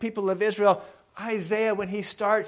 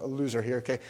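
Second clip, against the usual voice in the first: usual speaking voice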